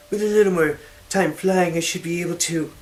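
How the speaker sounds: high, loud, eager voice; hammy stage actor. Acted. Amira voiced, then yawns.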